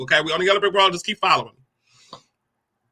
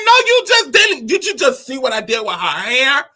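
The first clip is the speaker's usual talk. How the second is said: much higher, louder indignant rant; outraged rant; 6 semitones higher, 3 dB louder than usual. Retold or imitated. Child protests to the landlord about the doll, outraged.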